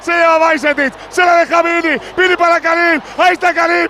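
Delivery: rapid build to a goal shout; breakneck goal commentary. Real, not acted. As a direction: call the goal play-by-play, rising. Live goal play-by-play building.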